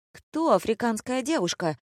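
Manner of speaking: high, affronted squeal without volume. A narrator's reading. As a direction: shoot the pitch up in outrage. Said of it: "взвизгнула Амаретта". (возмущённый взвизг, негромко)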